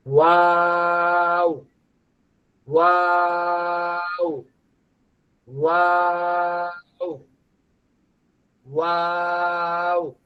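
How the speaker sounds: ওয়াও held long, lips rounded, slowly; drawn-out waw. Shown as a demonstration. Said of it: "ঠোঁটটা গোল হয়ে যাবে". (গোল ঠোঁটে টেনে ওয়াও)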